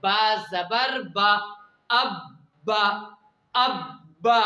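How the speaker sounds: slow, syllable by syllable, बा जबर बा; slow spelled drill. Shown as a demonstration. Teacher spells the syllables slowly for students, a slow drill. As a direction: drag each syllable out for the class. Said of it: "खुद कैसे?".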